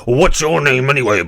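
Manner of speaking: loud bull voice; gruff bull. Acted. Bull questioning the ant, loud animal voice. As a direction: bellow it like a bull. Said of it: "the bull says".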